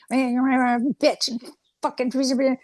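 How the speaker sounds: lightly voiced grumbling spirit; a hint of an irritated little spirit cursing; grumbled curses. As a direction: grumble the curses in a thin put-on voice. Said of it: "we can hear him grumbling".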